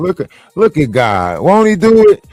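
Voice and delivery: loud, high, exultant shout-out; jubilant praise. Pretend. Celebrating that the truth came out, jubilant praise. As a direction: call it out like a testifying churchgoer.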